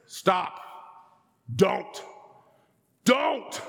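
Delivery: building to a shout. Retold or imitated. Dad losing patience with his toddler, building to a shout. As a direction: lose patience word by word. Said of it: "as the months went on".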